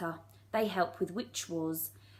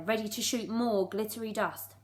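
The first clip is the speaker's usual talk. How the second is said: ordinary delivery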